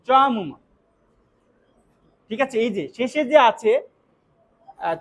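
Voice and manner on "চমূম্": চমুম with the last sound a clear m; final m. Shown as a demonstration. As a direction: end the word on a closed-lip m. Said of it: "ময় উচ্চারণ করতে হবে". (চমুম-এর শেষে ম উচ্চারণ)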